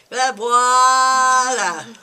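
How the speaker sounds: mock toddler whining for water; whining child's plea. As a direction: drag it out like a kid begging for the third time.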